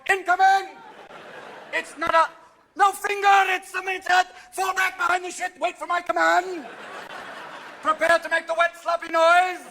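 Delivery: high, loud barked orders; army commander. Acted. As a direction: shout orders like a sergeant. Military commander voicing farts.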